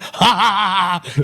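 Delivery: high, repeated comic cackle; high-pitched imitation belly laugh; imitated laughter. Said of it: "He'd start laughing".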